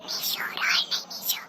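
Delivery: whispered, unvoiced aside; soft hushing whisper; hushed whisper. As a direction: whisper so the phone won't hear. Hushing crying Nishu on phone.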